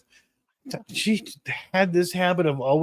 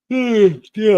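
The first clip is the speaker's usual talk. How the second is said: a yawn-stretched hmm; 7 semitones higher, 7 dB louder than usual. Real, not acted. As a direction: let a yawn drag the sound out. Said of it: "I'm yawning".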